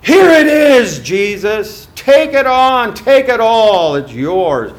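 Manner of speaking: loud, high tempter's cry; devil's shout. Acted. Preacher voicing the devil.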